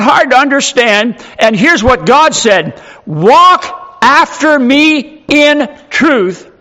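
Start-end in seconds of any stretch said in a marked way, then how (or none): 3.1-6.5 each word drawn out slowly and higher; slow emphatic quote. Acted. Pastor to congregation, quoting God very slowly and emphatically. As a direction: lay down God's command word by word. Pastor stressing God's words.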